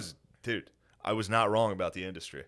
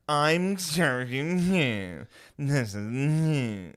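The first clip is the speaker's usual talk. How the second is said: pompous radio host; 6 semitones higher, 5 dB louder, much slower than usual. Retold or imitated. Mocking self-important radio hosts.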